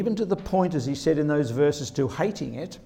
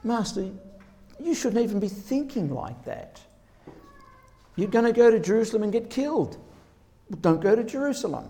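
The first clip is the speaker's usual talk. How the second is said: higher, urgent pleading voice of Peter; 8 semitones higher, faster than usual. Retold or imitated. Peter urging Jesus not to go to Jerusalem, higher pleading voice. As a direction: beg your master not to walk into danger, rushing. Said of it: "Peter said".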